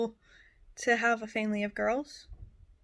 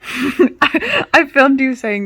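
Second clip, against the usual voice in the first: laughing; 3 semitones higher, 17 dB louder, much slower than usual. Real, not acted. Teasing friend, bursting out laughing.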